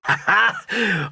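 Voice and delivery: short cocky laugh. Acted. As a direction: laugh before a boast. Eager character laughs before boasting.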